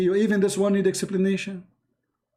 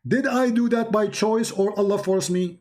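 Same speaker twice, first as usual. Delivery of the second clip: ordinary delivery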